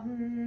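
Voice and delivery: a calm, steady hum; gentle hum. Shown as a demonstration. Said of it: "just hum".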